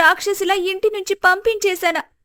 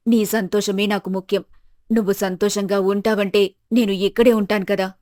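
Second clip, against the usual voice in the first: put-on tender older mother; 10 semitones lower, faster than usual. A narrator's reading. Mother-in-law comforting her weeping daughter-in-law, low and tender. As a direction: soothe her like an old mother forgiving a child.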